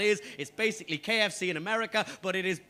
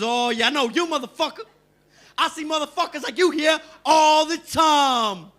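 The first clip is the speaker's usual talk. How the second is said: triumphant shout; 7 semitones higher, 8 dB louder than usual. Retold or imitated. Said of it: "He goes".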